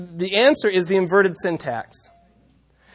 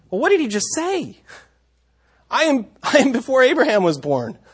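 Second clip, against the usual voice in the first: amazed; 7 semitones higher, 4 dB louder than usual. Acted. Teacher acting out the shock.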